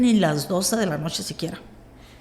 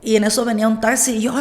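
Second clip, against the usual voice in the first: ordinary talk, nothing marked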